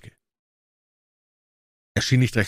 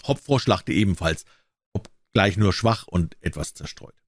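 ordinary delivery

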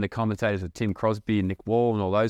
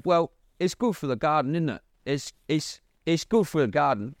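badly faked English accent, pitched higher; shocking English accent; 5 semitones higher than usual. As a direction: have a poor go at an English accent. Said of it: "that's a shocking English accent".

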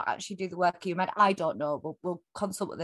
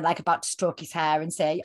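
ordinary talk, nothing marked

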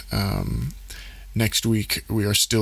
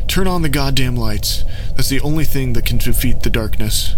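demanding shout, slightly higher; yelling; 4 semitones higher, 9 dB louder than usual. Acted. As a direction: order them to light it up. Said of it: "a man yelled".

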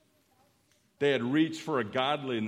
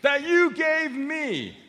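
slow, much higher shouted blame; emphatic shout; 13 semitones higher, 10 dB louder, slower than usual. Acted. Adam blames God for the woman, slow shout. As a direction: shout the blame slowly, word by word. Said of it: "I'll say it three times for emphasis".

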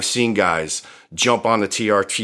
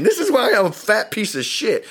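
mocking the lazy guy; 11 semitones higher than usual. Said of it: "snapping a fucking document on Instagram saying".

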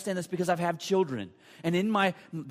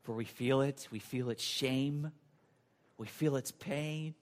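low, soft, hushed prayer; closing prayer; 10 semitones lower, 11 dB quieter than usual. Real, not acted. Pastor leading the congregation in closing prayer, low and reverent. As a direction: pray quietly with long pauses.

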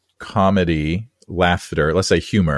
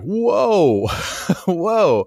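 mock surprise; 10 semitones higher than usual. Reacting to heckler being ejected.

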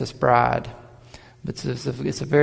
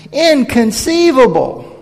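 high, loud, slow incredulous cry; the Apostle John shouting like a flabbergasted schemer; John as the indignant villain, crying it out; Vizzini; 9 semitones higher, 8 dB louder, slower than usual. Retold or imitated. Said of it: "I saw the Apostle John as Vizzini, that little guy, going".

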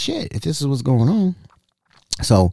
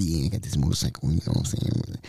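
low sous chef brag; 10 semitones lower, 10 dB quieter than usual. Retold or imitated. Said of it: "He was like".

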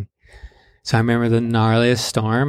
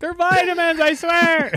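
very high, laughing plea; pleading brother. Retold or imitated. Brother pleading with the cops, high pleading laugh. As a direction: beg the cops to believe you. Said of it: "And he's like".

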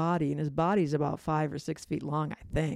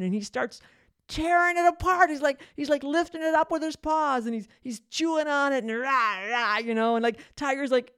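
breathless rush of disbelief at the chaos; excited retelling; 7 semitones higher, 5 dB louder than usual. Retold or imitated. Telling listeners the tiger wrecked the ottoman, excitedly. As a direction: pitch jumps way up and louder as the tiger goes wild.

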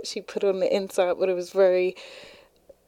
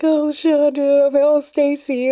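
gushing; 7 semitones higher, 6 dB louder than usual. Retold or imitated. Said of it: "and be like".

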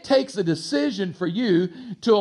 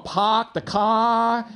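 mock New England accent, vowels stretched; 3 semitones higher, much slower than usual. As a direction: imitate a New Englander, r's dropped, drawn out. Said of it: "some of you from New England".

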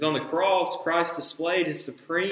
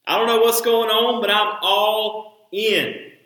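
eager plea; 6 semitones higher, 5 dB louder than usual. Acted. Peter eagerly asks for washing.

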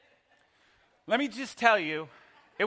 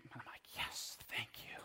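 whispered thanks; hushed grateful aside; 7 semitones lower, 20 dB quieter than usual. Retold or imitated. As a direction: breathe it with no voice.

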